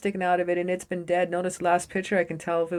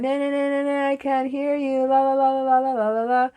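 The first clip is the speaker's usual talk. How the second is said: high, singsong la la la, fingers in ears; a kid refusing to listen, chanting over everyone; childish denial; 7 semitones higher than usual. Retold or imitated. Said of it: "fingers in his ears going".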